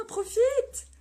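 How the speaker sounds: high, loud cheer of joy; excited cheer. Real, not acted. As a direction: shout it out to a friend on holiday. Cheering a viewer in Istanbul.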